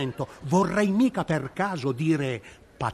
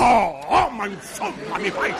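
exasperated shout; 3 semitones higher, 4 dB louder than usual. Acted. Exasperated outburst at partner.

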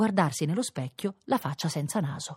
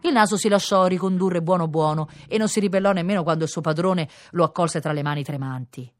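ordinary delivery, nothing marked

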